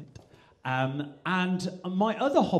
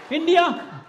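high, loud Indian accent; loud, falling Indian-accented shout; 13 semitones higher, 8 dB louder than usual. Shown as a demonstration. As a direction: call it out high in an Indian lilt. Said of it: "the Indians migrated to Wales".

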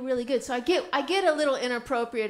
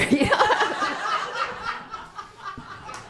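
big high laugh; real burst of laughter; 7 semitones higher than usual. Real, not acted. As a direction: crack up at the punchline. Laughs at own joke.

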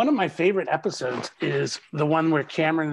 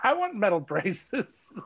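slow, higher-pitched mock plea; 6 semitones higher, slower than usual. Retelling a demand made on set, mock plea. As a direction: plead it slowly, half in jest. Acts out own past plea.

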